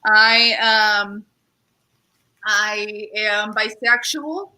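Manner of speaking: ordinary talk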